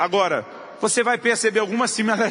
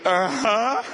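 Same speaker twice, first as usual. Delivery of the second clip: put-on child's voice; 4 semitones higher than usual. Son voiced in story.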